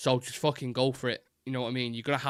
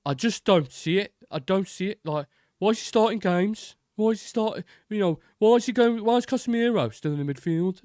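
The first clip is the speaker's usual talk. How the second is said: higher-pitched mocking pundit voice; mock Gabby Agbonlahor; 7 semitones higher, 4 dB louder than usual. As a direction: parody a baffled TV pundit, pitched up. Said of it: "he'll be there like".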